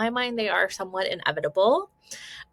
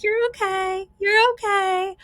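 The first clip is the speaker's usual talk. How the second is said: high, sing-song brush-off; dismissive reassuring parent; 12 semitones higher, 5 dB louder, slower than usual. Acted. Parent brushing off a hurt child, sing-song reassurance. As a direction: chirp it on a held high note. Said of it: "instead of just being like".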